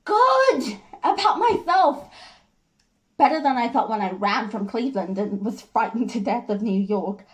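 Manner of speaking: proud declaration swelling high and loud, then easing; Jenny. Acted. Jenny tells George she feels good about herself, emphatic pride. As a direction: assert your self-worth, then settle into the memories.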